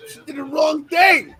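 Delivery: indignant shout. Retold or imitated. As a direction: call her out hard, outraged. Scolding celebrity over son.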